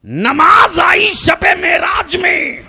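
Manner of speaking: high, drawn-out shouted proclamation; full-force preaching. Real, not acted. Preacher proclaims the night of Meraj to the congregation, full-force preaching. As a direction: cry it out slowly across the crowd.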